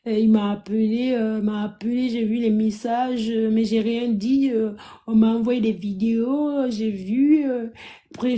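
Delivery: mimicking a listless talker, words dragged out; nonchalant imitation. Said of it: "Elle est là à parler nonchalamment".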